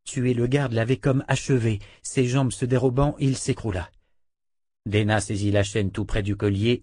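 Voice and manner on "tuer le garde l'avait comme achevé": ordinary delivery, nothing marked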